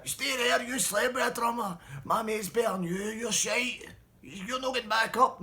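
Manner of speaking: high, loud mocking jeer; taunting shout. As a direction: keep the drummer off the stage. Bully blocks the drummer.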